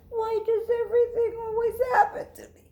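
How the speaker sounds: high, fast, tearful whine; mock-sobbing little girl; crying wail. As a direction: wail it like a wronged child. Lily's self-pitying wail.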